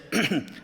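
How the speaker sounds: small attention-getting throat clear; hesitant servant's mock ahem; acted throat-clearing. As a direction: politely interrupt with a throat clear. Servant timidly gets king's attention.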